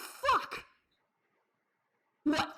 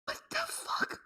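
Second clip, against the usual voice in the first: whisper; 12 semitones higher, 5 dB quieter than usual. Real, not acted. Stunned by character's death.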